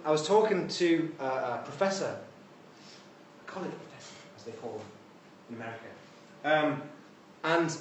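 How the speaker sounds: ordinary talk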